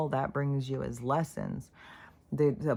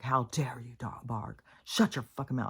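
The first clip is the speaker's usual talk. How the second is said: whispered mock scold at a barking dog; softer, whispered imitation of angry dog owners; hissed mock scolding; 3 semitones lower, 3 dB quieter than usual. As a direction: hiss it like a nasty owner. Imitating people scolding barking dogs.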